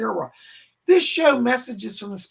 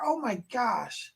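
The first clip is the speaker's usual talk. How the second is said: usual speaking voice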